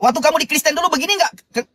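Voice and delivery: ordinary delivery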